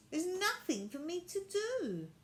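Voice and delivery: a lightly voiced bored girl; whiny girl.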